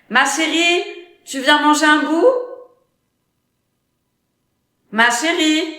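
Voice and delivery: loud call up the stairs. Acted. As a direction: call out to her daughter loudly. Mother calls daughter upstairs.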